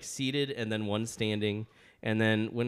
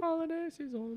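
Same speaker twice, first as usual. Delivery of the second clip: sing-song echo; 4 dB quieter than usual. Acted. Echoing holiday season jokingly.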